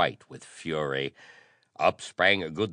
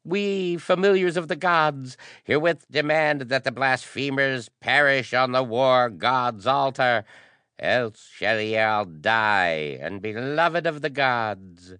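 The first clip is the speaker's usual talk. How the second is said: high priest's demand; 9 semitones higher, 7 dB louder than usual. A narrator's reading. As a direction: intone a ringing demand on behalf of the gods. Priest declaims a demand to the nobles.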